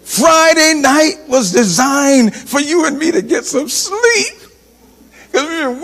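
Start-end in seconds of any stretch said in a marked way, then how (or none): none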